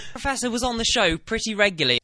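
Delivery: slow, grave tale of a fallen hero; dramatic storyteller. Pretend. Host spinning a mock legend about the professor, slow and grave. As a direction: tell it like an epic tragedy, slowly. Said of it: "I said".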